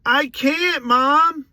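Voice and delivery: high, loud kid's holler, imitated; yelling back. Boy answering his mom from afar, yelling back. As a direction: shout back like a boy across a yard. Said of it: "I yell back to her".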